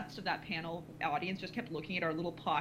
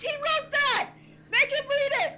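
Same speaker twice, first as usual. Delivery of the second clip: high, gleeful full-voice scream; screamed demand; 8 dB louder than usual. Retold or imitated. Audience member outing a friend to the host, high scream. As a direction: scream it out over a crowd. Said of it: "screaming at the host".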